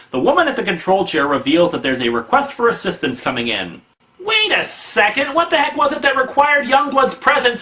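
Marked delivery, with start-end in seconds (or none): 4.2-7.6 high, mock-outraged disbelief; incredulous outburst. Reviewer interrupts the comic about Youngblood, incredulous. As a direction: stretch the opening, then rise in high-pitched protest.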